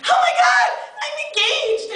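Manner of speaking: squealed very high, bursting with joy; mock giddy squeal; gushing excitement. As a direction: shriek the news like a thrilled bride-to-be. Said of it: "they'd be like".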